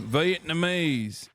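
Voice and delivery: slow Southern American 'Vietnamese', stretched as veet-nuh-MAYZ; Southern accent. Shown as a demonstration. Streamer says it Southern because chat asked, slow Southern accent. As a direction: drawl 'Vietnamese' out like a Southern good ol' boy. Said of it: "you hit that Vietnamese real southern".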